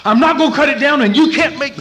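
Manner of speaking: high, loud mock of a defiant son; belted-out defiance; defiant yelling. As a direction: act out a shouted, stubborn refusal. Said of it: "here's what it sounds like".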